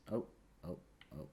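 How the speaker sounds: normal talking voice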